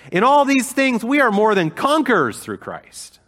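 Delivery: preacher's triumphant shout of Paul's words; triumphant proclamation. Real, not acted. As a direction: cry it out in victory. Climax quoting Paul's triumph.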